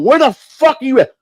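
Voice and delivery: high-pitched, exasperated phone demand, retold. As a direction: act out chewing out a late ride. Angry phone call to late ride.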